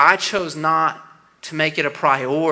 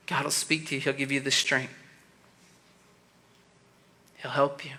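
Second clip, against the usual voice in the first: quiet closing appeal; 4 semitones lower, 6 dB quieter than usual. Acted. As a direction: lower the voice and plead gently with the students. Soft closing appeal to students.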